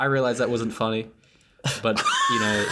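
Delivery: laughing through the words. Real, not acted. Laughs at his own joke.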